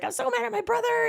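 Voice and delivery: high-pitched mock wail; retold upset outburst. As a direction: replay the phone outburst, high and overwrought. Retold tearful phone quarrel.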